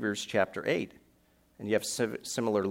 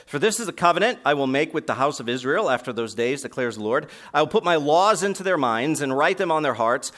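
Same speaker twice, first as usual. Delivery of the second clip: louder, higher preaching of the verse; full-force preaching; 4 semitones higher, 6 dB louder than usual. Acted. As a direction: proclaim it to the whole church. Preaching full force, reading scripture.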